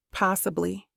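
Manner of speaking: plain reading voice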